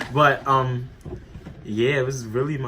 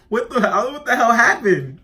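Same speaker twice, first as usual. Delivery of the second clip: much higher, louder alarmed cry; shocked shout; 12 semitones higher, 8 dB louder than usual. Retold or imitated. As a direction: yell it in disbelief. Retold alarmed reaction on phone.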